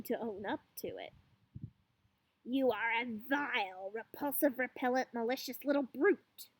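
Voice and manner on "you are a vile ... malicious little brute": plain reading voice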